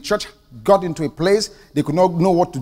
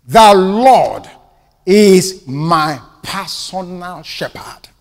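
slow, weighty stress on "The Lord is my personal shepherd"; emphatic and slow; 5 dB louder, much slower than usual. Shown as a demonstration. Preacher stresses the psalm for his listeners, slow and emphatic. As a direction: lean on "Lord" and stretch the rest. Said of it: "Do you see the emphasis?".

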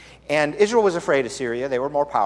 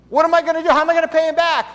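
mock panic; 6 dB louder, faster than usual. Borrower panics over lost axe.

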